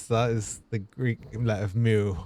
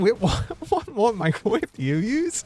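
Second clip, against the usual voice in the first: surprised; 11 semitones higher than usual. Real, not acted. Streamer baffled by microwave impression.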